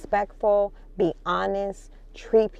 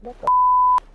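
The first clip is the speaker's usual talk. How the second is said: sudden shriek of surprise, pitched way up; startled; 5 dB louder than usual. Real, not acted. Vlogger startled while signing off, shrieking surprise. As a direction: yelp as if caught off guard.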